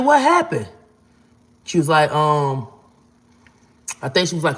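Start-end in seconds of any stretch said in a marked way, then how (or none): 0.0-0.7 shocked question. Retold or imitated. Pressing caller about friend's death, shocked. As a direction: pitch leaping up in disbelief.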